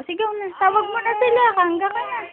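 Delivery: high sing-song coaxing; high baby talk. Acted. Mother sweetly urging her baby to call out.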